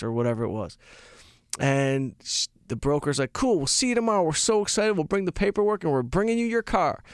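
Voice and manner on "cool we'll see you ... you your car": peppy broker. Retold or imitated. Imitating upbeat car broker.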